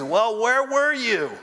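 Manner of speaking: high, drawn-out pleading cry; anguished questioning. Retold or imitated. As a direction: cry out to God, wounded and accusing. Acting out anguished questioning of God.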